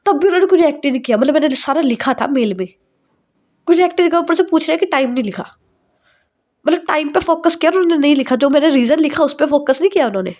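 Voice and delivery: frustrated venting. Acted. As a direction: pour out the hurt in a rush. Bride vents about fiancé.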